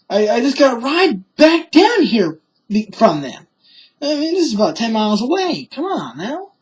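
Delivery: a touch of retold whining protest; mock exasperation. Retelling mother arguing with cops, mock exasperation.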